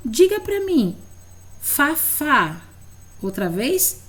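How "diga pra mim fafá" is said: pedido de professora. Shown as a demonstration. Professora pede que o aluno repita.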